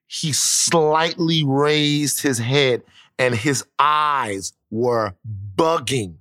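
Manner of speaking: very slow, drawn-out words; suspenseful telling. Real, not acted. Storyteller describes dad's reaction, slow and suspenseful. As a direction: stretch each word to build suspense.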